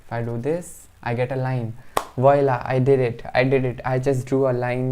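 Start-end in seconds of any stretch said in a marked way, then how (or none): 2.2-4.9 excited. Real, not acted. Program finally draws the line.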